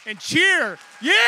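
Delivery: rousing shout to the crowd; loud cheer. Real, not acted. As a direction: pump up the room, full voice. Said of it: "cheer as loud as you can".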